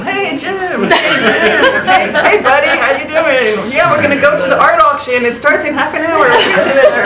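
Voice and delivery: high, loud mock-buddy calls with laughter; friendly hailing. Passengers calling out to Jim on the boat, laughing. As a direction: hail him like an old pal.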